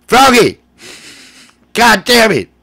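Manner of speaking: high, loud exasperated holler; mock yelling. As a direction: holler at Froggy in mock desperation. Mock yelling at Froggy for help.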